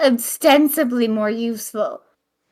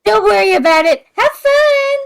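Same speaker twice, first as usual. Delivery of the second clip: character voice; 10 semitones higher, 7 dB louder than usual. Acted. In-character brush-off.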